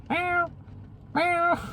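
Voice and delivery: cute imitated meows; cat meow. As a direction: meow like a small cat asking for something. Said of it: "and then goes".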